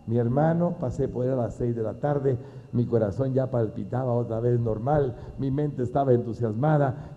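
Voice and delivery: ordinary talk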